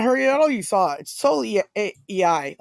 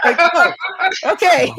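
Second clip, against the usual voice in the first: mom; 9 semitones higher, 5 dB louder, much slower than usual. Retold or imitated. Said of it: "she's like".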